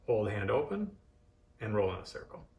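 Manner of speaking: normal talking voice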